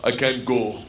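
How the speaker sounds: put-on low Russian-accented voice; low and resigned, Russian accent; Igor. Gangster friend turning down a train trip, low Russian accent. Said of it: "I say to Igor".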